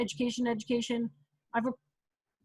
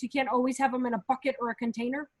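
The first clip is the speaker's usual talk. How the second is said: normal talking voice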